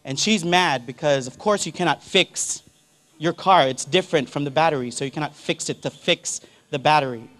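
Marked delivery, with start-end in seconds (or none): none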